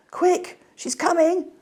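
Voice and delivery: high, loud little voices calling a warning; toy animals calling out. A narrator's reading. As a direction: toys warn each other she is coming, high and loud. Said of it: "calling to each other".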